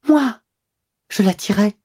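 high incredulous gasp of a question; shocked, can't believe the charge; stunned disbelief. Acted. The wife repeating the accusation, stunned and high. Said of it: "Elle murmura, livide".

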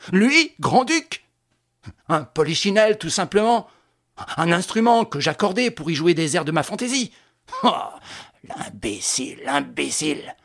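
Lupin seething at the idiot; raging. A narrator's reading. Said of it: "Repris de rage". (colère méprisante)

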